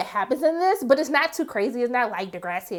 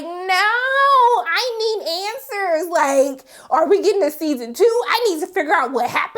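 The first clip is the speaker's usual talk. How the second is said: pitched-up mock despair at a cliffhanger; desperate for more; 7 semitones higher, 3 dB louder than usual. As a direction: beg the show for a season two. Reacting to cliffhanger ending.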